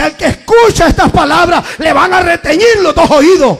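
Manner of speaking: God's loud warning. Acted. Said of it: "voy a pegar un grito".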